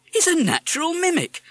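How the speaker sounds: high, loud wonder; amazed. A narrator's reading. Father admiring the parrot's mimicry, amazed. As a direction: exclaim it in high-pitched delight.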